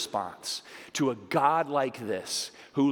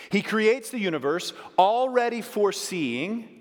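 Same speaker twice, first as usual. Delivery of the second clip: higher and louder, ringing proclamation; preached quote; 8 semitones higher, 5 dB louder than usual. Acted. A preacher reading Lewis to the congregation, ringing. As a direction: proclaim Lewis's words with full force. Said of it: "There's this crazy quote by C S Lewis".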